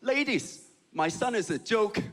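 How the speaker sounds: put-on high-pitched announcement in a Chinese accent; bragging Chinese mom. Chinese mom bragging to her friends, high Chinese accent. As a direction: show off your son in a Chinese mother's accent. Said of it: "my mom would tell all her friends".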